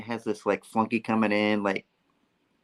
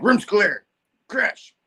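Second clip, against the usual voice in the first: mock henchman call; 10 semitones higher, 3 dB louder, slower than usual. Henchmen calling out in retold story.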